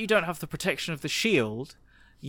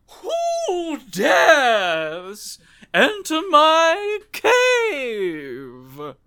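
booming dragon voice, drawn out; slow, echoing dragon challenge; growling dragon guarding treasure; 11 semitones higher, much slower than usual. Acted.

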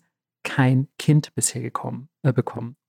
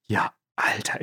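stunned whisper; 6 semitones lower, 8 dB quieter than usual. Retold or imitated. Stunned husband hears of pregnancy.

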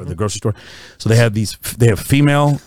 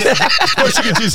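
laughing; 12 semitones higher than usual. Real, not acted. Laughing at the baby daddies meme.

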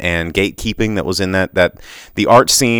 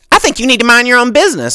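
shrill high screech of an angry customer; pterodactyl voice; 5 dB louder, faster than usual. Retold or imitated. Imitating the angry customer demanding a refund, pterodactyl voice. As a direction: squawk it like a pterodactyl. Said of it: "pterodactyl voice".

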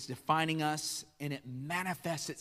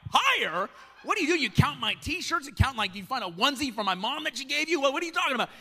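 much higher, loud, disbelieving question; hammed-up shock at the count; incredulous outburst; 9 semitones higher, 5 dB louder than usual. Husband disbelieving wife's T-shirt count, loud and high.

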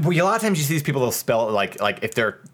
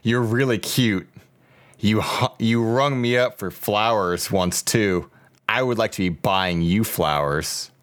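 slow, low post-reading voice; missed-connection read; 5 semitones lower than usual. Acted. Podcaster reads a Craigslist love note to Morgan, slow earnest read.

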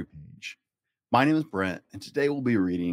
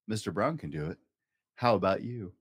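ordinary delivery

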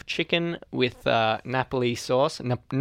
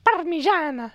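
put-on very high, over-the-top Italian accent; mock Italian; 4 dB louder than usual. Mocking the friend's claim about Italy, hammed-up Italian. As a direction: mimic a cartoon Italian, pitched way up. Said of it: "that's what they say in Italy".

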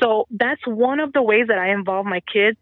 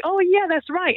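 put-on high, eager kid voice; agreeing students; 10 semitones higher than usual. Teacher imitating students agreeing with her, high and eager. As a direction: nod along like a convinced student. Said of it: "And they're like".

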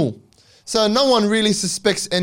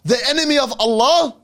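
high, raised call of alarm; retold yell; 8 semitones higher than usual. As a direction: cry out the name like sounding an alarm. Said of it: "he yells out".

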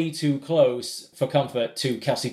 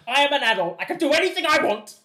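much higher, petulant mock Mrs Moon; 13 semitones higher than usual. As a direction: squeak it like a stubborn old mother, hammed-up. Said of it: "the other one is like".

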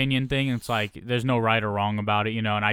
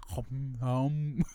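pouty pursed-lip hums; duck lips mimicking; 7 dB quieter than usual. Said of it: "She's like".

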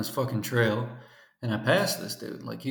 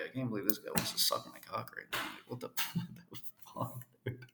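laughing disbelief; 2 semitones lower, 10 dB quieter than usual. Retold or imitated. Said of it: "I was like".

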